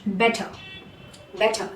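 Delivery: "better" ending in a schwa, no R; "better" ends in a soft uh; British better. Shown as a demonstration. Teacher showing British pronunciation, R dropped. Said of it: "whereas in British".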